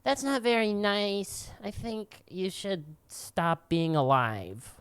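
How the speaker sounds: high, slow prissy whine; mock him with fake politeness; slow, high mock of a prissy man. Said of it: "It's just like".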